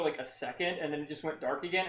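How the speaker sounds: normal talking voice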